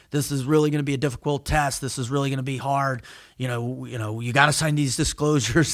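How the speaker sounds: low, monotone warning; priming for negativity. Acted. Researcher priming subjects for a hard test, discouraging. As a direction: discourage them in a low, muted voice. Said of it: "they've primed them for negativity".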